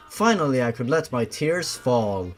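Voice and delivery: plain reading voice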